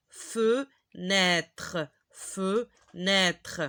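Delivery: Fenêtre in two slow pieces, fe, nêtre; syllable by syllable. Shown as a demonstration. Teacher starting over for learners, fenêtre broken into syllables. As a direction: sound out FENÊTRE, pausing after fe.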